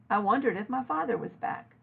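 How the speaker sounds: usual speaking voice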